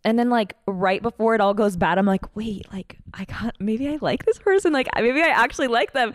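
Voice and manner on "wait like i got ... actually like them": higher-pitched, second-guessing herself; mock wide-eyed realization; dawning realization. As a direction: act out a hopeful sudden doubt. Acts out sudden realization of liking someone.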